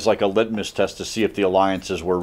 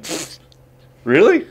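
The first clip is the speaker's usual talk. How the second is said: scoffing surprise; 9 semitones higher, 3 dB louder than usual. Retold or imitated. Russia scoffing at Austria's offer.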